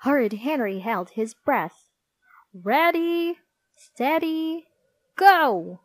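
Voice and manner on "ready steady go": high, sustained race-starter call; loud starter's call to the runners; Miss Battle Axe's starting shout. A narrator's reading. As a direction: hold the first two high, then punch the last. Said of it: "shouted Miss Battle Axe".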